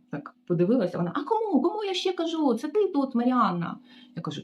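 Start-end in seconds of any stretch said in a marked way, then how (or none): 1.1-3.8 much higher, scornful mimicry; mocking the receptionist. Maid mimicking the receptionist questioning her, high scornful voice. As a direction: squeak it like a snooty boss.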